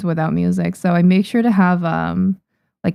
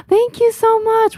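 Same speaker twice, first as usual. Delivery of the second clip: high, gasping thank you; gasp, then gush with thanks; excited thanks; 13 semitones higher than usual. Real, not acted. Gasps at a viewer's purchase.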